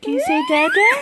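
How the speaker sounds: put-on thrilled baby-talk prompt; excited coaxing. Shown as a demonstration. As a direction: squeal the question at the baby with glee. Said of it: "dad coaxing baby to say Dada".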